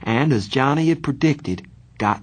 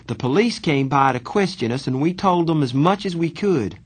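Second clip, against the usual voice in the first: put-on higher-pitched young brother's voice, earnest; earnest letter writer; 7 semitones higher than usual. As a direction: read the letter as its worried writer.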